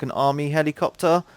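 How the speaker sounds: ordinary delivery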